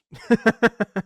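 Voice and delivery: loud, high burst of laughter; amused laugh. Real, not acted. Amused by own correct answer.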